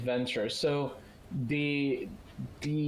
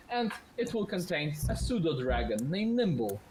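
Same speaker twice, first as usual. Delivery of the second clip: normal talking voice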